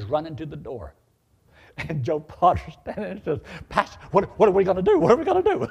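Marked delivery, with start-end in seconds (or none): 3.7-5.7 high, loud comic panic with a laugh; panicked. Retold or imitated. Missionary asking the pastor what to do, panicked. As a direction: repeat the question, rising in alarm. Companion panics as gunman arrives.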